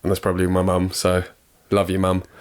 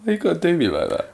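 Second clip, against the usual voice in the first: mock hurt; 10 semitones higher than usual. Playful hurt complaint.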